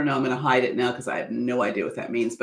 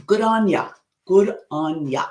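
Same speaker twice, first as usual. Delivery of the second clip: slightly raised, deliberate Australian-accent attempt; 3 semitones higher, 3 dB louder than usual. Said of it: "I still have a terrible Australian accent".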